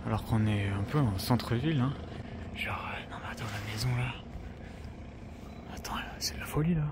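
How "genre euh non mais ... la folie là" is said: hushed excited whisper. Real, not acted. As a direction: whisper it, amazed. Admiring a house at night, hushed.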